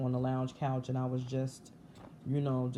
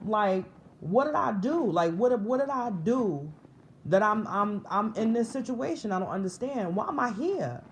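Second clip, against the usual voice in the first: raised, pleading retold questions; sincere frustration; 6 semitones higher, 4 dB louder than usual. Speaker retells asking God about childhood hurts, frustrated pleading. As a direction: replay bewildered protest, higher and insistent. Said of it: "but it was sincere".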